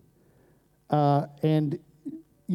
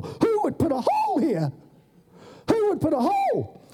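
put-on much higher, outraged old onlooker; indignant bystander. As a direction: demand to know who dug it. Crowd gathers, indignant.

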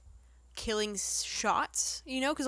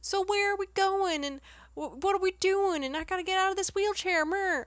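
mock Franklin's whiny Texan accent; 9 semitones higher than usual. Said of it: "the best slash worst Texan accent".

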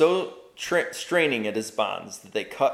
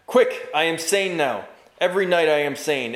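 loud, higher urgent cry; loud and clear; 6 semitones higher, 5 dB louder than usual. Acted. Bound knight urging the children, loud and clear. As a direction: call out urgently, fully awake. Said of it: "he said loud and clear".

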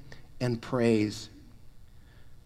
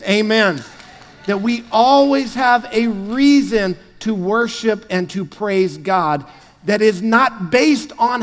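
rising, louder preaching build; booming motivational speech; 2 semitones higher, 4 dB louder than usual. Acted. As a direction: build the sermon to a peak. Building sermon toward full force.

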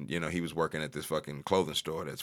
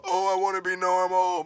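high mocking whine; mock whiny voice; 13 semitones higher, 6 dB louder than usual. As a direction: whine it like a sulky hero. Mocking Hulk's wish.

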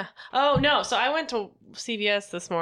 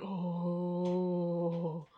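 quiet deflated oh; 3 semitones lower, 8 dB quieter than usual. Retold or imitated. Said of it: "I was like".

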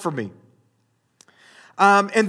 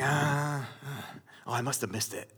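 low, very soft muttering to himself; deflated self-talk; 6 semitones lower, 14 dB quieter than usual. Retold or imitated. Man checking his phone alone, muttering dejectedly. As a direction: mumble it under your breath, much softer.

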